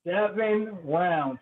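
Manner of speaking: ordinary delivery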